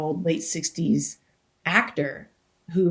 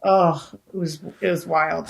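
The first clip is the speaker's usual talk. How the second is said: ordinary talk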